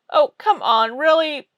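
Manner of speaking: loud, high incredulous outcry; can't believe it; exasperated. Real, not acted. Exasperated at website options.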